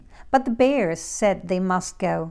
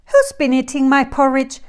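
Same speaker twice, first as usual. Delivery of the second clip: higher, steadier mother bear voice; Mama Bear, pitched up and projected; middle-sized mother bear; 4 semitones higher, 7 dB louder, faster than usual. A narrator's reading. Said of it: "said the middle-sized bear in her quiet voice".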